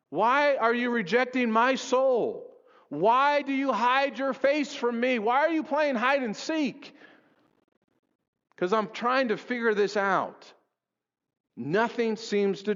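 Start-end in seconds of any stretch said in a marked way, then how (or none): 0.1-6.9 higher, pleading questions to God; anguished pleading. Acted. Preacher voicing the psalmist's cry to God, anguished.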